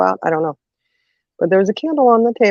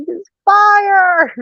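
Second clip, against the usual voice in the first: alarmed cry; 4 dB louder than usual. Retold or imitated. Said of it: "And goes".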